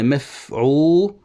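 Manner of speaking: slow, long-drawn syllables; metrical foot demo. Shown as a demonstration. Teacher naming the matching foot, slow scansion. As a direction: sound out "مفعولات" with stretched long vowels. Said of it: "وهنا تنتهي التفعيلة".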